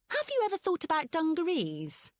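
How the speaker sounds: high, sweetly polite jab; fake-innocent polite question. A narrator's reading. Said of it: "Pippa asked politely".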